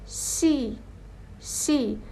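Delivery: "شی" as SH plus long EE, each separate. Shown as a demonstration. Teacher models the Korean syllable for learners, "شی" with SH. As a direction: say "شی" with a hushing SH. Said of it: "من نگفتم سی".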